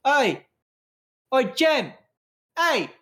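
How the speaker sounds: high, loud hail across a bar; calling out. Acted. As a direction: holler repeatedly to catch someone's attention. Calling out to a contact.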